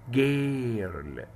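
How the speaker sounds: جيرل said slowly with a hard g, as ger-l; slow demo girl. Shown as a demonstration. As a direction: stretch جيرل out, hard g at the start. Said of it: "طيب بنت بقى".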